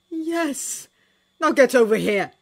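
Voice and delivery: emotional call. Acted. John calling Sherlock to him.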